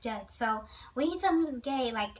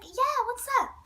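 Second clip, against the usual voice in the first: put-on high, slow girlish voice; little girl voice; 11 semitones higher, slower than usual. To the narrator, Nate answers in a girlish voice. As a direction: mimic him like a little girl. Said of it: "that's how he sounded".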